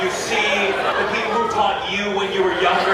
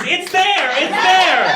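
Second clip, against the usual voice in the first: mock nurse insisting; 12 semitones higher, 4 dB louder, much slower than usual. Mocking nurses pointing at organ.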